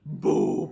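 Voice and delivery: very deep, loud drawn-out boom; explosion sound. Shown as a demonstration. Therapist demonstrates a sound effect for play, deep explosive boom. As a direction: blast out a low booming explosion. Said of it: "I like explosion sounds".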